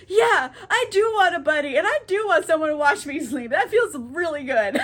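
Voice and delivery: lightly mocking the needy ghost-haunted guy; playful happy whine; eager, comforted. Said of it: "Ruben's like".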